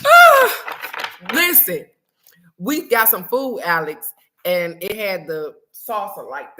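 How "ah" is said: sudden loud high yelp; loud cry. Real, not acted. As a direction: yelp out with glee. Reacting, overwhelmed with laughter.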